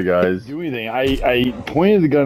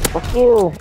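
yelling; 7 semitones higher than usual. Real, not acted. Yells at enemy then laughs.

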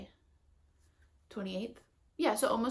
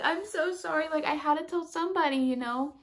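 laughing apology; 8 semitones higher, 5 dB louder than usual. Retold or imitated.

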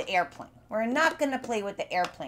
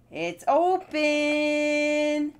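happy reveal; 6 semitones higher, much slower than usual. Acted. Jar finally opens for kids.

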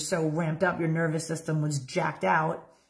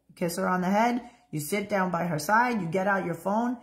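ordinary delivery

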